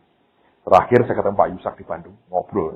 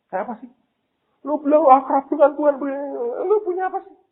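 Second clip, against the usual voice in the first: much higher, louder sneering voice; mocking skeptic; 4 dB louder than usual. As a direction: scoff like a doubter taunting him. Mimics scoffing people. (orang sinis mengejek, suara tinggi dan keras)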